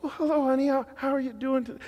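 mock sweet, high and gentle, a bit fast; hammed-up tender greeting to a wife; gentle husband voice. Dad showing son how to greet his wife, high and gentle. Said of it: "You need to talk like this".

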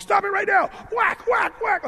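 high, loud screaming mom; a frazzled mother losing it in the store; furious shout. Retold or imitated. Kmart mom screaming at her child in the aisle.

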